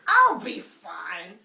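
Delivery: very slow, loud slurred whine; drunk girl. Acted. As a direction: drag it out drunkenly.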